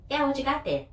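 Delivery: chirpy cashier asking across the counter; imitating a curious store clerk; nosy cashier. Cashier insists, retold.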